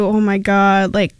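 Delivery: normal talking voice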